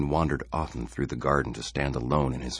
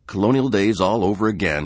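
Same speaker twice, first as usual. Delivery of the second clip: declaring; 6 semitones higher, 7 dB louder than usual. Acted. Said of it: "he declared".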